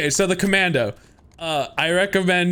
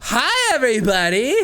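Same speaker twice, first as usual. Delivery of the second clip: put-on high, gushing Valley Girl; 9 semitones higher than usual. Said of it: "Valley Girl's a lot of fun".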